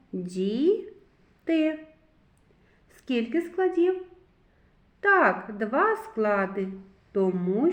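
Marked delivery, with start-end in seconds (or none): 0.1-1.9 "Діти" read as two held syllables with a gap between. Shown as a demonstration. Teacher reads for children, "Діти" slowly split into syllables. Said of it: "Прочитаємо".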